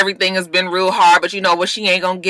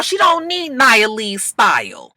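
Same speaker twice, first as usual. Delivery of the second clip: ordinary talk, nothing marked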